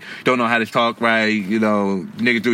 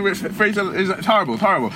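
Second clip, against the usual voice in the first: ordinary talk, nothing marked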